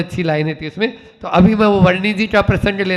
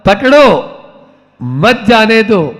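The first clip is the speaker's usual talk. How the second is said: "पकड़ो" high and loud, pause, then "मत जाने दो"; pause after पकड़ो; 4 semitones higher, 4 dB louder than usual. Shown as a demonstration. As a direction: break before मत and join it to जाने. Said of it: "बस इतना ही अंतर है". (पकड़ो के बाद लंबा विराम)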